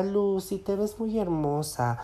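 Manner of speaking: softly cooing a compliment; sweet tenderness. Retold or imitated. Client admiring Lucy, soft and sweet. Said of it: "como dulzura".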